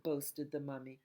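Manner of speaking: ordinary delivery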